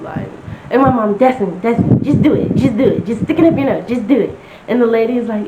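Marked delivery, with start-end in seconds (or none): 1.2-4.4 mom urging. Retold or imitated. Mom urging daughter to swab.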